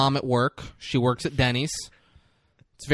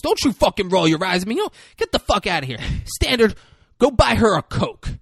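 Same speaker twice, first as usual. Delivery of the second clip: fast, higher, loud telling-off; angry rant; 6 semitones higher, 4 dB louder than usual. Retold or imitated. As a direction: bark at her for rolling her eyes. Cook yelling at Blanche.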